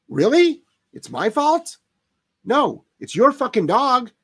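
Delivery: outraged sarcastic pitch; sarcastic disbelief. Real, not acted. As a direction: scoff at the owner who blames you. Mocking owner's blame.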